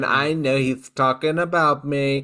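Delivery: loosely attempted presidential impression; lightly put-on voice for a dog's thoughts; Trump. Co-host voices the attention-seeking dog, hint of an impression. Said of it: "you're doing your Trump face".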